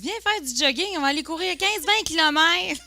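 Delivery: high, loud, enthusiastic pitch; mock eager invitation. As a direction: rope a friend into it, high and lively. Joue l'amie qui propose un jogging. (invitation enjouée, exagérée)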